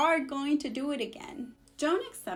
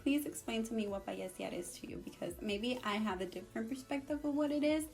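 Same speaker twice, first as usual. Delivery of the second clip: quiet; 4 semitones lower, 7 dB quieter than usual. Retold or imitated. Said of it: "I'm like".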